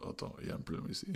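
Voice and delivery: hushed, breathy whisper; whispered aside. Retold or imitated. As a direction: whisper it as a private worry. Retold own puzzled reaction.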